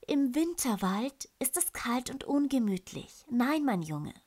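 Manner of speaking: a mother gently saying no; gentle mother bear. Acted. Mother bear refuses her cub.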